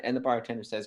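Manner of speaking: normal talking voice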